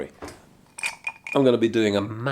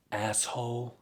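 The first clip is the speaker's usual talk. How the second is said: muttered aside; 10 dB quieter than usual. Acted. Quiet aside to viewers about Farage. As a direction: spit it out quietly as a running gag. Recurring muttered insult gag.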